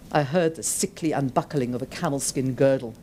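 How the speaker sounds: ordinary delivery, nothing marked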